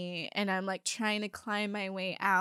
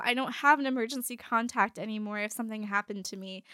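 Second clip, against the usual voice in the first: ordinary talk, nothing marked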